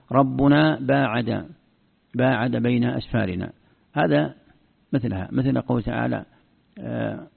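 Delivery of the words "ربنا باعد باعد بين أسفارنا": "ربنا" said rabbunaa, "باعد" said baa'ada; slow "باعد" with a-vowels, baa'ada; past-verb variant. Shown as a demonstration. Teacher offers another reading, "ربنا باعد" as rabbunaa baa'ada. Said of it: "أو تقول".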